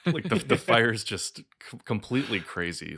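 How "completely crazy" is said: ordinary delivery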